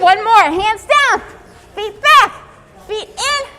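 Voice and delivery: upbeat coach counting out each move; rhythmic workout cues. Acted. PE teacher calling burpee steps to the kids, rhythmic cadence. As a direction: keep the kids on the beat.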